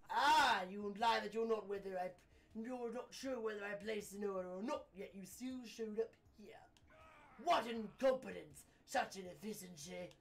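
indignant posh British client; snooty British gentleman, pompous and put out; posh British man. Acted. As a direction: scold the courier in haughty British tones. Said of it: "he sounds like a posh British man".

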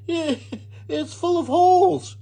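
very high, drawn-out wail; Missus Rogers dismayed. A narrator's reading. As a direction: wail slowly in horror. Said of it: "exclaimed Missus Rogers".

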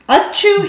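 high loud sneeze; acted sneeze. A narrator's reading. Reading Noisy Me, a loud high sneeze.

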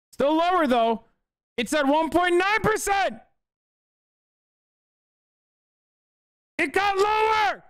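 high-pitched, worked-up outburst; excited disbelief. Real, not acted. Streamer yelling at chat about the stat, high and excited. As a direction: crow it out in mock outrage. Streamer reacting to falling statistic.

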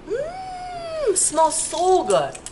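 long high hum, then slow delight; savoring a smell. Real, not acted. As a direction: breathe it in and savor it. Sniffing shower gel in shop.

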